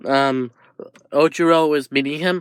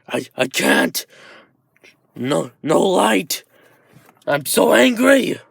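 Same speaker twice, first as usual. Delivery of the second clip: strained voice building to an angry cry; slow, struggling start, rising into rage; angry monster; 2 semitones higher than usual. Acted. Dark Shadow grows out of control, acted anger. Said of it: "getting bigger and angrier".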